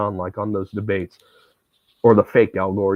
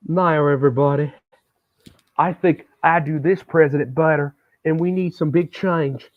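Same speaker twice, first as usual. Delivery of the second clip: higher, folksy presidential campaign voice; a hammed-up Bill Clinton impression; 6 semitones higher than usual. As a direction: stump like a higher-pitched Southern politician. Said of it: "That's my impression of Bill Clinton".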